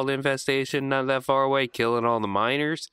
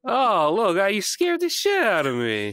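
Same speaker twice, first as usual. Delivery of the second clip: raised, louder voice after a fright; startled protest; 7 semitones higher, 4 dB louder than usual. Acted. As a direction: exclaim like you just got badly spooked. In character, reacting to scare.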